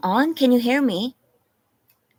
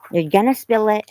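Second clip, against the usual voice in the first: louder, higher warning to the dog; 5 semitones higher, 7 dB louder than usual. Real, not acted. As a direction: raise the voice to warn the dog. Warning the dog with food bowl.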